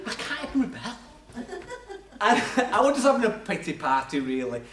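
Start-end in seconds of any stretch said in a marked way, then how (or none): 0.1-1.0 laughing, defeated; chuckling at his own failure; wry laughing sigh; rueful laugh. Retold or imitated. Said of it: "And I'm thinking".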